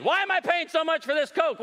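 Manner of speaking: high-pitched indignant complaint; mock complaint. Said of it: "Somebody complained".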